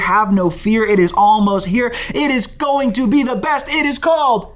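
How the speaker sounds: announcer build-up, getting bigger each line; building hype. Acted. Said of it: "I, like, kept building".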